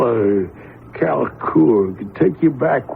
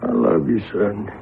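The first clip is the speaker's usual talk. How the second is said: low, soft last words to a son; dying father's quiet love to his son; tender farewell; 6 semitones lower, 3 dB quieter than usual. Acted. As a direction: say goodbye gently, fading.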